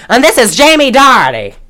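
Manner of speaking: lightly hammed-up mom; imitating the mother. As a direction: present the boy grandly. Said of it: "And then she goes".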